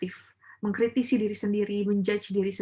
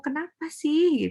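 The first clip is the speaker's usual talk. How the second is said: exasperated question; 7 semitones higher, 4 dB louder, slower than usual. Retold or imitated. Acting out frustrated tajwid learner.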